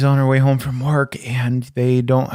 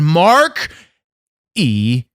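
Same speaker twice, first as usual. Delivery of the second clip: ring announcement; much slower than usual. Acted. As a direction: call your own name like a ring announcer. Announcing own name.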